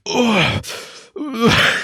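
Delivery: high mock retching groans; imitated gagging. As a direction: imitate someone starting to gag. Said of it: "like he starts gagging".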